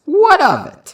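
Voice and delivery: defiant snap. Acted. Danny defies the teacher about his church, loud and defiant. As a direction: throw the question back hard.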